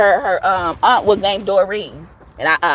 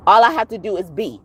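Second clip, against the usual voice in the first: ordinary talk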